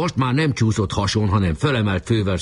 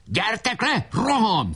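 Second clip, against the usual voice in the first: high urgent call; calling back while running; 14 semitones higher, slower than usual. A narrator's reading. Leader answers the fort while running, high call. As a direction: summon them with a high cry. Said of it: "felelt futtában".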